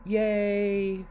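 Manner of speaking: high, loud, drawn-out cheer; delighted cheer. Real, not acted. Streamer celebrates getting a new brush, real delighted cheer. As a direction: let out a long, high-pitched hooray.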